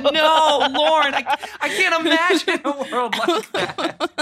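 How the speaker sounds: high, laughing sarcastic disbelief; mock disbelief.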